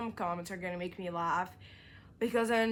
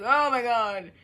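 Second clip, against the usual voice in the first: retold shock; 3 semitones higher, 10 dB louder than usual. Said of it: "I was like".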